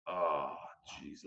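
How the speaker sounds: low, soft, sinking sigh of words; dismayed groan. Retold or imitated. Arriving cop recognises the officer, low dismayed groan. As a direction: let it drop, quietly dismayed. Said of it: "I could see on his face, he goes".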